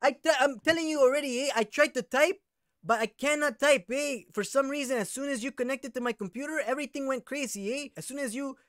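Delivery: hammed-up Chicano slang voice. Prank caller in a Chicano accent berating the scammer. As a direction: play a touchy Chicano vato with a Chicano accent.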